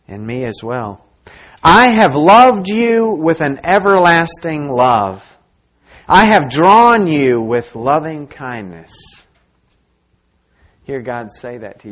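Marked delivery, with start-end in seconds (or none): 1.6-8.9 loud, slow scripture proclamation; God's declaration. Acted. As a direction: proclaim it slowly and forcefully, as God's own words. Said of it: "God tells you this".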